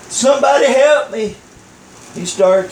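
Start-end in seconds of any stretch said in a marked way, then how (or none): 0.1-1.4 higher-pitched mock call for help; crying out for help. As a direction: cry out as if stranded, joke-telling style. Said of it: "he was crying out".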